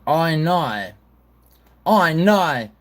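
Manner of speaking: high, exaggerated Australian vowels; hammed-up Australian "oh no"; Australian accent. Shown as a demonstration. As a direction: pitch it up like a mock Australian. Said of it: "in an Australian accent".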